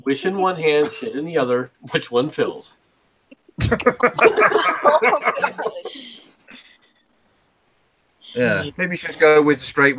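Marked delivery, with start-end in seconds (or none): 3.6-6.0 high burst of laughter; real laughter. Real, not acted. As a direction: laugh at your own crude joke. Laughs at own crude joke.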